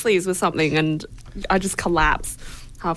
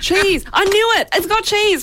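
excited; 11 semitones higher than usual. Real, not acted. Guessed cheese, excited win.

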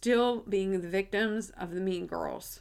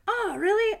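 surprised; 12 semitones higher, 5 dB louder than usual. Retold or imitated. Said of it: "And I was like".

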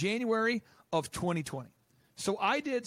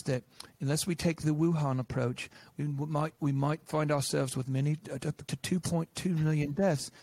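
a lightly put-on, somewhat lower scientist's voice; Neil Ferguson voice; 4 semitones lower than usual. Mimicking the modeller announcing his forecast, lightly put-on lower voice. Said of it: "this is how he talks".

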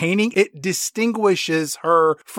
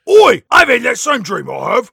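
high cockney shout then chatter; mock cockney; 6 semitones higher, 6 dB louder than usual.

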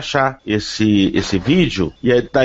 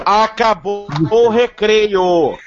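drawn-out, higher-pitched playful announcement; mock calling to order; 5 semitones higher, much slower than usual. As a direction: stretch it out like calling kids in from recess. Mock call to end the chatter.

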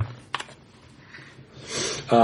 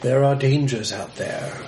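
gruff mountain man. Acted. Hermit warns of danger.

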